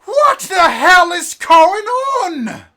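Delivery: huge, slow, roaring shout from high up; thunderous booming demand. Acted. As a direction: bellow it so the whole tavern shakes. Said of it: "this kind of booming voice go".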